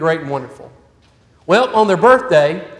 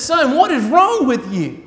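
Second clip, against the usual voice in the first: exasperated mom; 5 semitones higher, slower than usual. Acted. Mom exclaims at son.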